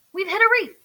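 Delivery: high, urgent warning; alarmed. A narrator's reading. Earl warning Buddy of the reef, high and urgent. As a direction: sound the alarm.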